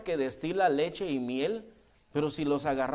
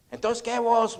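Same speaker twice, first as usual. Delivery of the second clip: higher, louder retold challenge; landlord calling out; 6 semitones higher, 5 dB louder, slower than usual. Landlord stops the departing tenant, calling out. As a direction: voice a nosy landlord hailing the tenant.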